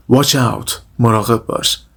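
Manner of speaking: ordinary delivery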